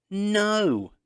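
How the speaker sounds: high, loud startled "No!"; a sudden shout to stop the rabbit; sharp no. A narrator's reading. Girl shouting at the rabbit to stop, loud and sharp. Katya stops rabbit eating cone.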